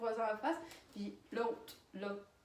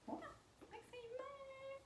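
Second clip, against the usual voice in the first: very high, soft amazed echo; mock awe; 14 dB quieter than usual. Marvelling that he grabbed it bare-handed, high and soft. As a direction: breathe it out in high disbelief.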